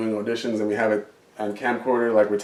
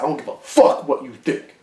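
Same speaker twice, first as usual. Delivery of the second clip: mock angry actor's snarl, a bit louder; in-character anger; 4 semitones higher, 4 dB louder than usual. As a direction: get in his face in character. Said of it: "I actually got mad".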